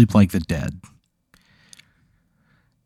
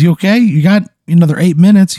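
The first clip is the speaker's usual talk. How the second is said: much higher, louder, friendly creature voice; cheery snooze monster; 9 semitones higher, 6 dB louder than usual. Acted. Snooze monster greeting Pat with a high, chummy voice. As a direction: greet him like an old buddy, high and bright.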